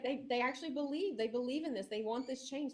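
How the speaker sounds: high, amazed voice; awed realization. Retold or imitated. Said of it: "And I was like".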